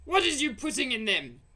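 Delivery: loud, high demanding bark; demanding giant. Acted. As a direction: demand an answer.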